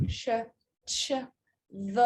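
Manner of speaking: Sh, Ch, V as bare sounds with a short "uh" after each; teacher's separate consonant sounds for Sh, Ch, V; isolated consonant sounds. Shown as a demonstration. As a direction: sound out Sh, Ch, V one at a time, pausing between. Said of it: "These are all consonant sounds".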